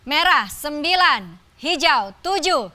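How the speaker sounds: slow, drawn-out score reveal; booming prize announcer. Acted. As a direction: build suspense toward the winner.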